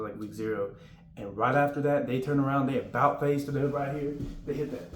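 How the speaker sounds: ordinary talk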